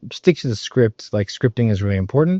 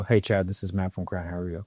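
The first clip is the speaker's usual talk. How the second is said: low, soft, downbeat phone greeting; low-key opener; 5 semitones lower, 11 dB quieter than usual. Shown as a demonstration. Sales coach showing a rep a contrasting cold-call opener, low-key and soft. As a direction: drop the pitch and volume, trailing away. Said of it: "they're totally different".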